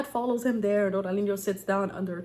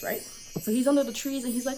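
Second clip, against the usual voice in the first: ordinary delivery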